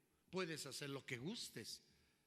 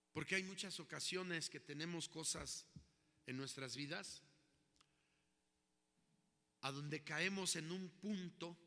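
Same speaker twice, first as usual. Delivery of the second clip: ordinary talk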